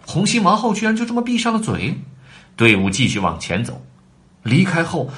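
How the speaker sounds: plain reading voice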